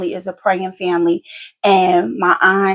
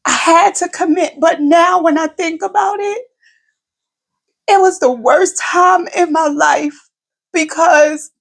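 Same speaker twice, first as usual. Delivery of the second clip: higher, tearful voice as she recalls it; real emotion breaking through a mother's story; getting emotional; 7 semitones higher than usual. Real, not acted. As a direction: voice rising with emotion, holding back tears. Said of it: "I'm getting emotional".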